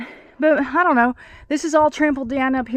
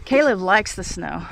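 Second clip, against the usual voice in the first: usual speaking voice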